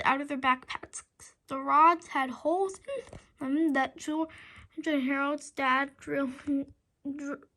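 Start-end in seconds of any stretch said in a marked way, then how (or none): none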